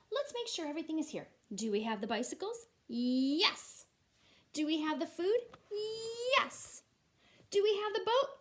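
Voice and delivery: dad counting off gear, climbing in pitch; cheerful dad checking list. Acted. Dad asking his family before the trip, bright and rising. Dad voiced in story read aloud.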